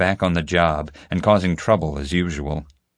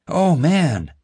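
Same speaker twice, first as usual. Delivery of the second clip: a mock-alarmed gasp, pitched up and louder; fake gasp; 9 semitones higher, 4 dB louder than usual. Boy faking alarm at a text to escape Chloe, a put-on gasp. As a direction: pretend shock at a fake text, loud and high. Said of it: "I gasped".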